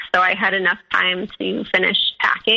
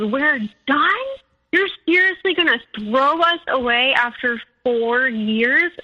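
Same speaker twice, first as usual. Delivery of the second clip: put-on higher-pitched, disbelieving boyfriend; incredulous hurt; 4 semitones higher than usual. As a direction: imitate a dumped partner's protest. Boyfriend reacts to being dumped.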